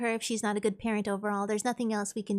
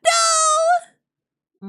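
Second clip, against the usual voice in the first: loud, high-pitched shriek of refusal; screamed no; 13 dB louder than usual. Acted. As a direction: scream the no, drawn out. Loud refusal to wear mustache.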